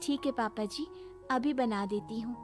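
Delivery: low, soft, submissive assent; meek compliance. Acted. Daughter-in-law agreeing to cook another breakfast, meekly. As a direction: give in quietly and obediently.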